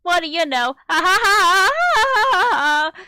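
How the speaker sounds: loud, high, mechanical fake cheer; robotic forced cheer. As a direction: act relentlessly upbeat to block it out, ending in big fake laughs. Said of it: "talking in a robotic monotone voice, trying to forget".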